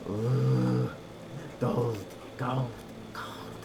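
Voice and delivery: cackling greedy kobold with a draconic lilt; half dragon voice. Acted. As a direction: croon over the gold like a little dragon. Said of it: "almost cackling with a small draconic accent".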